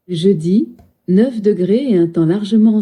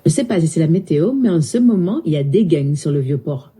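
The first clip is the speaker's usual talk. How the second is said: quick, swinging Marseille accent; southern French accent; 2 semitones lower than usual. Shown as a demonstration. Assistant joking about the Vieux-Port, southern French accent. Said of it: "parle avec l'accent du sud".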